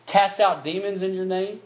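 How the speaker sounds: preaching at full force. Acted. Preacher booming the words of Jesus to the church.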